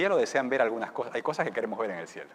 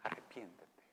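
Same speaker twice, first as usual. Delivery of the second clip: tearful plea; 5 semitones lower, 10 dB quieter than usual. Acted. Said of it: "con lágrimas en los ojos".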